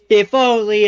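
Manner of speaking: mock-wishful if only, pushed up a bit; sarcastic. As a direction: say it clearly not meaning it. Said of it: "very obviously not meaning it".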